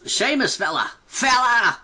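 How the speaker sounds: loud high Irish-style shout; Sheamus shout. Retold or imitated. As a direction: bellow it like a wrestler. Imitating Sheamus catchphrase shout.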